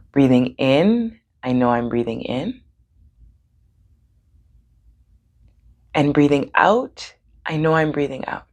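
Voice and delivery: calm breathing guidance with long pauses; guided meditation. Real, not acted. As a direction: lead them gently, leaving room for each breath.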